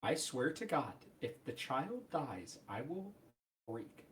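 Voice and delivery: slow, hushed warning, dropping away; quiet threat. Real, not acted. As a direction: warn them very softly, word by word. Dreading the child's death, threatens.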